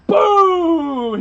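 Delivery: loud, high mouth-made boom; imitated gunshot boom. Retelling a friend firing, a loud gunshot boom. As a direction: shout boom like a shotgun blast.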